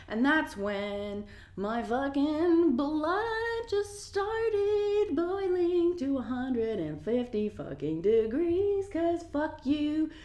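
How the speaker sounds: very slow, stretched, rising pitch; seething outrage. Real, not acted. Furious at the bystander's put-down, seething exaggerated outrage. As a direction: draw every word out in a high, boiling singsong.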